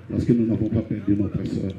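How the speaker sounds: ordinary talk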